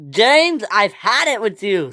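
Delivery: high, loud, fed-up outburst; exasperated shout, highest on the name; angry scream. Acted. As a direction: yell that you are at the end of your patience. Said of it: "screamed Gordon".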